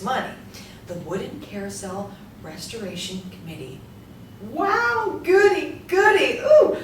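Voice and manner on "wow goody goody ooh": high, loud squealed goodies; giddy delight. Acted. Joan gushes over another generous committee check. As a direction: gush like a delighted child.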